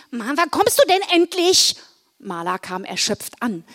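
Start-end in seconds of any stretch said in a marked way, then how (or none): 0.1-1.8 high-pitched, impatient call. A narrator's reading. Wilma waiting at the shed for Marla, impatient high call. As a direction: call out to someone who is taking too long. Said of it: "rief sie".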